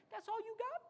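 high scornful question; mocking taunt. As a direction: needle him. David mocking Goliath.

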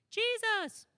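put-on high, loud childlike answer; little kid answering. Little boy calling out an answer from the back pew, high and loud. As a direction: pipe up like an eager child. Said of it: "a little kid... he says this".

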